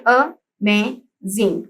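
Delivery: "अमेजिंग" said slowly in three separate syllables; "अमेजिंग" drawn out slowly, syllable by syllable, teacher demo; slow syllable split. Shown as a demonstration. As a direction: stretch "अमेजिंग" out syllable by syllable for the class. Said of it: "यानी कि three sounds आ रही हैं".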